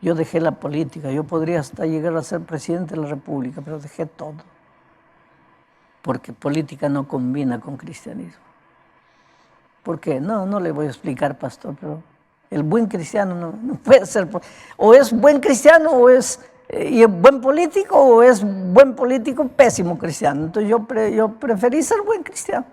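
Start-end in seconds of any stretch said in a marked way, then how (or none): 0.0-8.4 much softer, a hushed confession; confiding politician. Retold or imitated. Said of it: "un día me dijo". 12.5-22.7 higher and louder, hammering the either-or; emphatic politician. Retold or imitated. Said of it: "un día me dijo".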